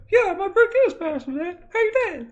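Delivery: very high, loud put-on voice; high-pitched son character; squeaky son. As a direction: squeal it proudly to dad. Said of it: "you can talk normal now".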